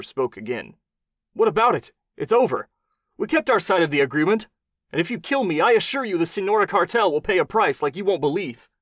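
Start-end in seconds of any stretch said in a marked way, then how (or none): 1.3-8.7 much higher, louder indignant protest; defiant official. A narrator's reading. Attorney General argues threatened.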